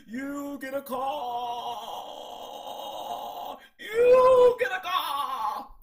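high, slow, booming mock Oprah giveaway; dramatic Oprah impression. Oprah giving calls to the queens, hammed-up shout. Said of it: "way too dramatic".

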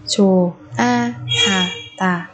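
slow Chùa a ha tà with gaps; syllable by syllable. Shown as a demonstration. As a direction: separate each syllable of Chùa a ha tà. Teacher splits word by syllable. (đọc chậm Chùa a ha tà từng âm tiết)

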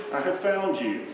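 shepherd gently soothing the found lamb; tender shepherd. Acted. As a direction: comfort the lamb in your arms. Shepherd holds lamb close.

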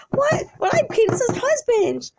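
high-pitched indignant squawk; play-acted incredulous cry; outraged disbelief. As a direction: protest in hammed-up shock. Husband confronts the boyfriend.

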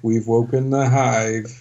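slow, portentous bot impression; lightly hammed-up game companion robot; Drinklage Bot. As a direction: draw it out like a bot's warning. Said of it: "Destiny Drinklage Bot voice is".

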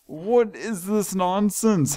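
high, slow, disbelieving scold; exasperated scolding. Real, not acted. Player scolding an escaped dinosaur, high exasperated scold. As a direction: scold the runaway like a fed-up keeper.

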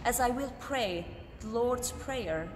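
ordinary delivery

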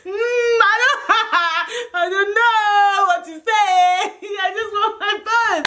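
high, sobbing mock wail; hammed-up crying. Pleading to the police for the phone, hammed-up bawling.

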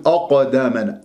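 أقدامنا begun with a dark o-like أ; heavy hamza, wrong. Shown as a demonstration. Teacher showing the other mistake, heavy hamza. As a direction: darken the opening أ towards o. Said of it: "تفخم الهمزة تقول".

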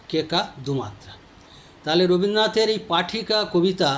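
ordinary delivery

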